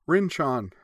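high-pitched call of a name; crying out. A narrator's reading. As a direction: cry out to a friend just arrived. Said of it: "Yui cried".

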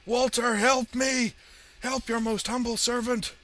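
high, loud begging cry; desperate shouted plea. A narrator's reading. Villain begs his master for help, desperate high shout. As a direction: scream for rescue, frantic.

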